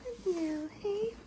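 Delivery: high cooing voice to a horse; pet talk. Real, not acted. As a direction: coo softly to a sleepy pony. Talking to her horse.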